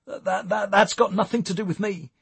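high, stammered protest. A narrator's reading. Eric denying the cannabis is his, protesting. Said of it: "he protested".

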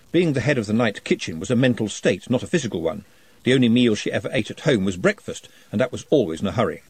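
usual speaking voice

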